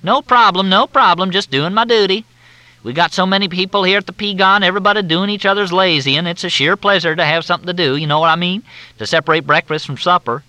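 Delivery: high, loud, syrupy Southern drawl; Southern officer on the phone, in a thick drawl. Acted. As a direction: drawl it out like a courteous Southern major. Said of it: "The drawl was thicker than Mississippi mud".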